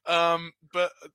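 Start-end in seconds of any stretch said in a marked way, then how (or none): none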